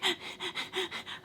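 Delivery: high, soft pulsed panicky breaths; mock panicked panting, quick little bursts; freaking-out noise. Showing viewers what her brain does, freaking out. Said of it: "like, freaking out".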